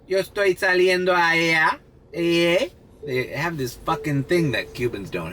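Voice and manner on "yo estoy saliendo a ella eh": high, sing-out Dominican Spanish imitation; Dominican accent. Showing viewers how Dominicans talk, imitated Dominican accent. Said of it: "I love the way the Dominicans speak".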